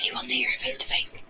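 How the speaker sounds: hushed unvoiced whisper; frantic whisper. Real, not acted. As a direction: breathe it out, no voice. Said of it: "I have to whisper because".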